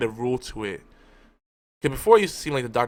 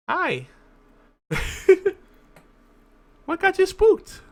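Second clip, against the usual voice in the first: much higher, louder sing-song pet voice; 5 dB louder, slower than usual. Real, not acted. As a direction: coo at the cat as she jumps up. Talking to the startled cat.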